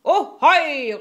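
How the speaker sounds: high, loud drawn-out hail; pirate shout. A narrator's reading. Pirate Killer answers the captain, shouting. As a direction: holler it across the deck. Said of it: "råbte Killer".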